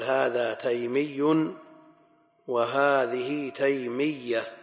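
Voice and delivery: slow, emphatic geminated ya in "تيمي" and "تيمية"; "تيمي", "تيمية" with a heavy doubled ya. Shown as a demonstration. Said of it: "بالتشديد".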